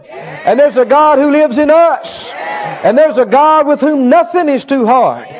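slow shouted sermon climax; rising preaching shout. Real, not acted. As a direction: build it up for the congregation.

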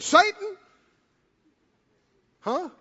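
Jesus sternly rebuking Peter; stern rebuke. Retold or imitated. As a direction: cast Satan away sharply. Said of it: "Jesus turned to Peter and said".

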